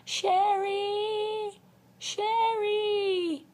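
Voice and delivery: high, drawn-out mock holler of a name; imitated yell down stairs. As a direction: imitate calling loudly down a stairwell. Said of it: "she'd yell down the stairs".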